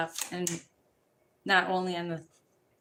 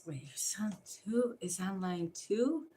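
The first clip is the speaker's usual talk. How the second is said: hushed, unvoiced aside; whisper; 6 dB quieter than usual. Real, not acted. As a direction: whisper it like a secret.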